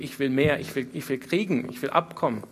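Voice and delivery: normal talking voice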